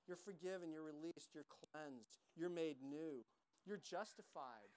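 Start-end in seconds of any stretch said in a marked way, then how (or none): none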